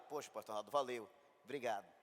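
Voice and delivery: low, offhand thanks with no fuss; unfazed man. Retold or imitated. Man answering the miracle news, low and offhand. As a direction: shrug it off like a man hearing news. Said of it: "Homem é assim".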